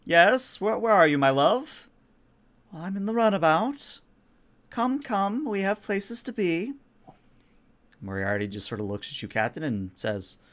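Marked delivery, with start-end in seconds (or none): 0.0-1.9 high, loud eager lover's reply; startled and yearning, calling back; startled Moriarty. Acted. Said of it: "starts and looks around and says". 2.7-6.7 higher coaxing countess voice. Acted. Countess voice over comms.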